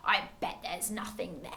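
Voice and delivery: low, soft, dismissive mutter; scoffing. A narrator's reading. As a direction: scoff under the breath.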